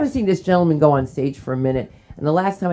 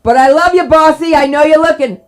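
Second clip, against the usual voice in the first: loud shout-out; 10 semitones higher, 12 dB louder than usual. Real, not acted. Shouting out to the boss.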